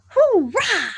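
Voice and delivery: cheerful high shout. Shown as a demonstration. Kids' song cheer, happy shout. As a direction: cheer it out loud. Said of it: "Give a shout".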